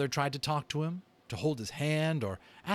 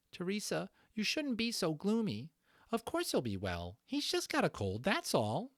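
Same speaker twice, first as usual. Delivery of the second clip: put-on higher voice for a young sister mouse, brushing off worry; a girl mouse telling her sister not to fret, pitched up; Cynthia the young mouse; 10 semitones higher than usual. Read-aloud story, Cynthia speaks.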